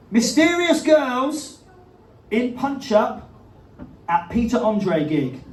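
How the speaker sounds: slow, grand headline announcement; dramatic headline read. Pretend. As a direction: announce it slowly like big news.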